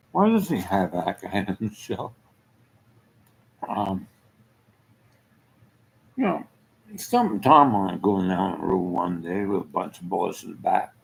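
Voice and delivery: ordinary delivery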